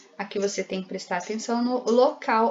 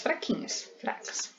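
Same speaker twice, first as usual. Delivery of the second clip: very soft, almost whispered; tiny, feeble whisper; weak and faint; 3 semitones higher, 10 dB quieter than usual. Shown as a demonstration. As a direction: drop to a voiceless hush to show weakness. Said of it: "as demais são sílabas átonas".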